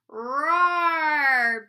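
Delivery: loud, long roar; big swelling roar, drawn right out; bellowing dragon. A narrator's reading. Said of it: "bellowed the dragon".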